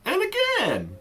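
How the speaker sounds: very high pitch leap; voice going high. Shown as a demonstration. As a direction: shoot right up high on it. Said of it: "I just did it right there".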